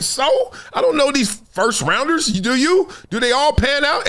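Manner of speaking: high, skeptical squeak; high-pitched dismissive questions; mock dismissive. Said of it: "And I was like".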